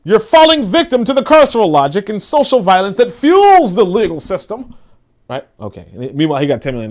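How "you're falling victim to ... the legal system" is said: pitched up, louder, pompous academic mimicry; hammed-up sanctimonious lecturer voice; mocking his lofty jargon. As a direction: parody his preachy activist speak. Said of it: "this is how he talks".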